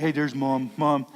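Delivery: low, lightly voiced dog; a hint of a dopey dog spotting Mom; voicing the dog. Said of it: "He's like".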